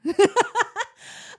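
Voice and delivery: high-pitched nervous giggle; a bright burst of nervous laughter. Real, not acted. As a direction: laugh off the heaviness. Nervous laugh about hard topic.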